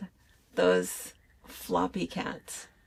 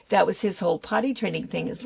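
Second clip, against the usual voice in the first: ordinary delivery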